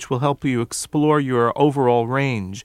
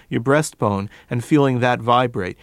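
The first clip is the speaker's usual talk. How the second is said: normal talking voice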